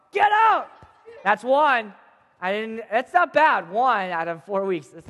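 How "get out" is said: high, loud shouted order; angry yell. Acted. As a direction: yell to throw them out. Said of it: "yelled at them".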